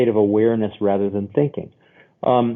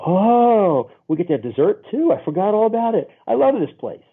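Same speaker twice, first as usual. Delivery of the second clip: high, gushing delight at a surprise treat; pitched-up mock delight at dessert; 7 semitones higher than usual. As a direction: act thrilled that dessert showed up. Delighted diner quote, pitched up.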